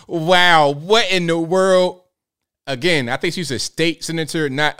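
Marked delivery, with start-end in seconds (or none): 0.0-2.0 stunned disbelief. Real, not acted. Stunned reaction to clip.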